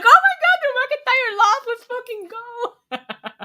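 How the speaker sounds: high, loud burst of glee; excited cheer. Retold or imitated. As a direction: squeal it like your team just won. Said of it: "I didn't realize how excited I got".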